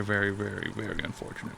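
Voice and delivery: normal talking voice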